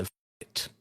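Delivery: breathed, voiceless, almost silent; whispered curse. Retold or imitated. Robber deciding alone in his car, whispered curse. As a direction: say it to himself with no voice.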